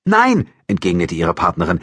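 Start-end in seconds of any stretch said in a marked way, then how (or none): 0.0-0.5 high, loud cry; sharp, raised reply; alarmed denial. A narrator's reading. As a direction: shout back a startled no. Partner replies after the gunshot.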